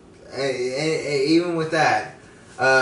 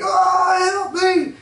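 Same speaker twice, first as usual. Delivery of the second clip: high, loud mock cry for help; shrill-pitched imitation of a desperate scream; imitated scream for help; 5 dB louder, much slower than usual. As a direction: yell it like the stranded guy screaming. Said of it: "he hears the guy scream".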